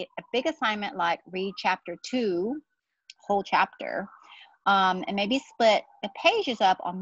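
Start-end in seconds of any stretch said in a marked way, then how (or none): none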